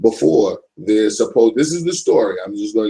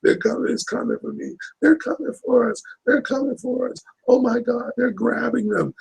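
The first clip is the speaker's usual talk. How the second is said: high, thin panicked cry at no great volume; hammed-up hysteria, pitched way up; hysterical mock scream; 14 semitones higher, 3 dB quieter than usual. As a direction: imitate a terrified woman screaming over the radio. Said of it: "she's so hysterical, screaming".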